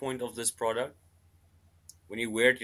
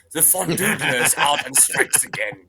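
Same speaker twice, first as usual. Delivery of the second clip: news headline voice; 3 semitones higher, 7 dB louder than usual. Retold or imitated. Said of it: "the name a news company or a newspaper would give".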